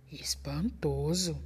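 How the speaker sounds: slow, breathless whisper of amazement; awed whisper. Acted. Gruffalo amazed the snake fled, slow whisper. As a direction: whisper it in stunned disbelief.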